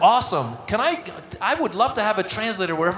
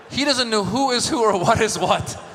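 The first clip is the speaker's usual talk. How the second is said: ordinary talk, nothing marked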